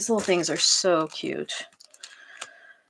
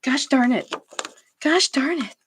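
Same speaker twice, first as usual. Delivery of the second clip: higher, louder frustrated "gosh darn it"; mock cursing at herself; 7 semitones higher, 4 dB louder than usual. Cursing at herself over burnt cornbread, higher and louder frustration. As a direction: scold yourself in a mock curse. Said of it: "that's how I was cursing at myself".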